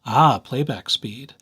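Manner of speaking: normal talking voice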